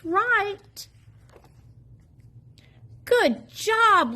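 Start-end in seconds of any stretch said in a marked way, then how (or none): 3.0-4.1 high, bright proud cheer; high cheering praise. Acted. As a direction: praise him like a proud grown-up. Praising Little E.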